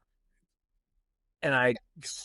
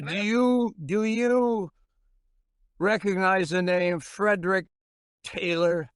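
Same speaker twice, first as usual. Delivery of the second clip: lightly voiced old man; 6 semitones higher than usual.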